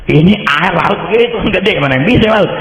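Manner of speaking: high, worked-up disbelief at the sea; exasperated exclaiming. Retold or imitated. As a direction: throw up your hands at the waves. (heran dan bersemangat)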